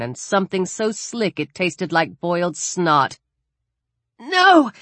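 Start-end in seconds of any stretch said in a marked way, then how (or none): 4.2-4.7 high-pitched, horrified gasp; gasped cry. A narrator's reading. Alex recoils as her gorge rises, gasping in horror. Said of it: "she gasped".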